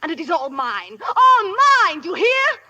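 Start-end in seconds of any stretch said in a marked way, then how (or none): 1.1-2.6 emphatic cry. Acted. Heiress insisting on her treasure.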